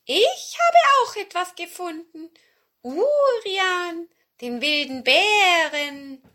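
high, loud cat voice; drawn-out high cat announcement; old cat. Acted. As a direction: present it triumphantly as a cat. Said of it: "sagte die ururalte Katze".

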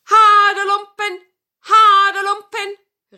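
high, loud, drawn-out hawker's call; street vendor's cry. Acted. Rag collector passes the house crying his trade, loud sustained call. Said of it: "rief er".

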